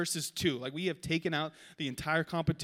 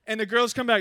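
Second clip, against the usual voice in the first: ordinary talk, nothing marked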